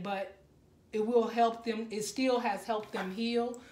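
ordinary talk, nothing marked

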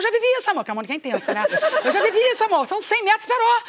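mais aguda e alta, exagerando; Mônica intensa prevendo o pior; dramática. Retold or imitated. (Mônica dramática, voz aguda e alta)

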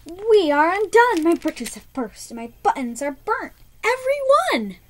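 high, loud distressed lament; crying out. Acted. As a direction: wail it like all is lost. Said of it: "he cried".

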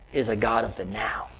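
low whispered point. Acted. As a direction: drop to a whisper for emphasis. Sermon point, dropped voice.

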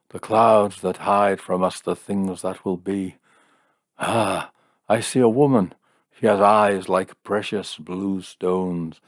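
theatrical mystic peering into the future; fortune-telling seer. Acted. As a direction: intone a grand vision, drawing out the words.

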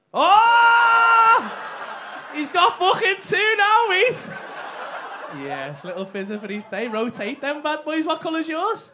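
loud, high, laughing hype; roaring excited banter; hyped shouting. Real, not acted. Hyped about two cars.

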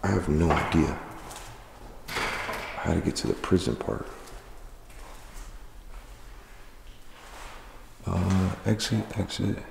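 hushed, nervous ghost-hunt murmur; nervous night vlog whisper. Real, not acted. Alone at night in haunted prison.

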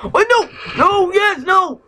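very high alarmed outburst; shocked cry. Real, not acted. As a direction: yelp in sudden shock. Shocked reaction to game result.

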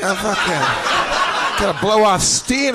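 lightly voiced principal cutting loose, drawn out at the end; cocky partying principal. Liar posing as principal to strangers at a bar, cocky. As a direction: stretch it out like a principal on a night out.

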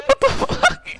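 ordinary talk, nothing marked

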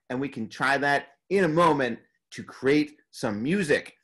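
ordinary talk

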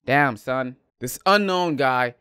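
ordinary delivery

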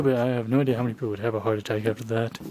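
rushed, fast aside; talking too fast. Real, not acted. Vlogger rushing a thought about scaring viewers, fast. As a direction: race through the sentence. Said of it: "I'm talking too fast".

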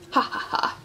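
mock villain's chuckle, unvoiced and dry; a short, cruel "ha ha ha". A narrator's reading. Man with the camera gloats over sweetheart's headlines, cruel chuckle. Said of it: "He chuckled cruelly".

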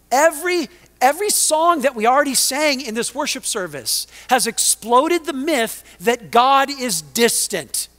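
loud, high, emphatic sermon delivery; impassioned preaching. Real, not acted. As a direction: proclaim it to the whole congregation, punching key words. Preaches at full force.

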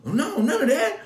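high, loud protesting son; son's denial. Retold or imitated. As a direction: insist like a flustered teenager. Son denies trouble.

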